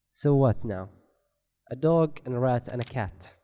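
usual speaking voice

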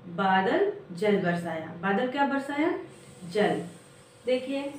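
ordinary talk, nothing marked

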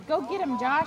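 loud encouraging yell; cheering shout. Real, not acted. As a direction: cheer the player on.

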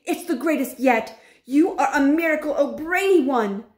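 high, loud, jubilant crowd voices; cheering yell. A narrator's reading. Witches praising their leader's success, jubilant yelling. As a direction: cheer each phrase high and elated. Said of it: "yelled the audience".

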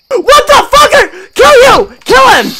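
crying out high and loud in terror; real panicked shrieking; terrified scream. Real, not acted. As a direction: scream as it lunges at you. Jump scare in game.

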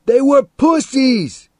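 loud, high-pitched taunting yell; high defiant shout; shouting back. A narrator's reading. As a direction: hurl it back at full shout. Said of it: "Teddy shouted back".

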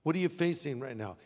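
ordinary talk, nothing marked

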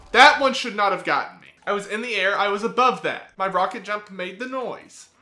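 loud, outraged protest; indignant complaint. Real, not acted. Indignant complaint after death.

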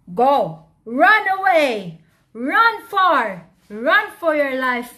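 loud, high urgent lion shouts; booming storybook order to flee; shouted command. Acted. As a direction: send the mouse running with short loud orders.

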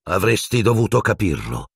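ordinary delivery, nothing marked

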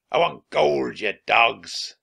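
loud, growling pirate demand; forceful villain voice; angry pirate captain. A narrator's reading. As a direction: berate your crew like a greedy pirate boss. Pirate captain berates his crew.

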